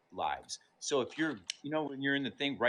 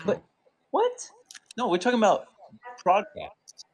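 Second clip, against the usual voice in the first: surprised; 8 semitones higher, 8 dB louder than usual. Real, not acted. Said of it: "surprised exclamation".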